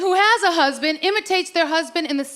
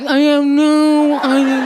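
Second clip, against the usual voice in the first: slow, louder buck-toothed mumble; hunched, dopey old husband; buck teeth impression; 2 semitones lower, 6 dB louder, slower than usual. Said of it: "we hunch our back".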